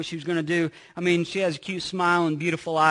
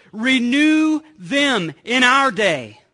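slow, louder plea, words stretched out; pleading cry; 4 semitones higher, 6 dB louder, much slower than usual. Retold or imitated. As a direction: cry it out to God. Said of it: "He's crying out to God".

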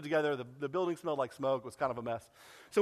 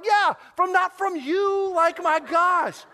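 drawn-out, much louder and higher mock exasperation; exasperated disbelief; 13 semitones higher, 8 dB louder, much slower than usual. Pastor to pregnant volunteer, exasperated and loud. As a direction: scoff slowly, stretching not from you.

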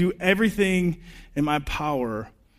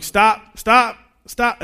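calling out; 6 semitones higher, 8 dB louder, much slower than usual. Retold or imitated. Calling after runaway child.

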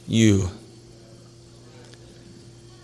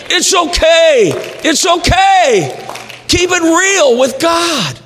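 high, ringing preacher's shout; full-force preaching; 12 semitones higher than usual. Real, not acted. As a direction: preach it hard, pitch soaring on key phrases.

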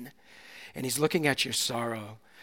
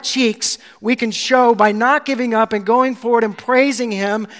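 loud, higher, driving sermon climax; full-force preaching; 6 semitones higher, 11 dB louder than usual. Real, not acted. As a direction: build it up and thunder it to the church.

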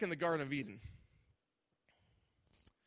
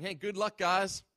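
breezy, dismissive "good luck, guys", pitched up and louder; mock flippant farewell; 4 semitones higher, 5 dB louder than usual. Preacher mocking an indifferent Jesus, flippant. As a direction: toss it off like you don't care.

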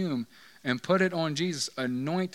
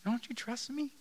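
Jesus voice; 9 semitones higher, 6 dB quieter than usual. Retold or imitated. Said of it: "And he's like".

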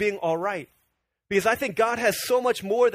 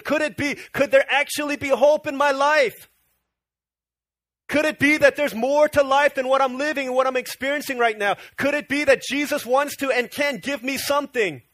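desperate hope; 5 semitones higher, 4 dB louder than usual. Acted. Blind Bartimaeus hearing Jesus is near.

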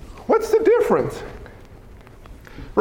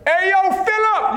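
high street-style holler to a buddy; hammed-up disciple hailing a friend; disciple calling out; 10 semitones higher than usual. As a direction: call across to your pal, pitched way up. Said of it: "They said like this".

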